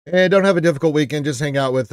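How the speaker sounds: cheerful call-out. Real, not acted.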